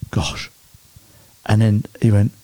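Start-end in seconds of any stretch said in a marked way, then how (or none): 0.1-0.5 retold stunned whisper; hushed awe. New father retelling the first heartbeat on the scan, hushed awe. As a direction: whisper it as if reliving the shock.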